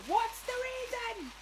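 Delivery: very high, drawn-out squeal; squealed question. Pretend. Squealed echo of own phrase.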